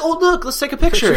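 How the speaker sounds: high, airy tourist voice; mocking clueless tourist. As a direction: play the oblivious parent, sing-song. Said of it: "And they're like".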